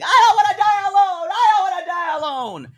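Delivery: high desperate wailing scream. Retold or imitated. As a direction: wail it out in panic. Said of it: "Their id is screaming".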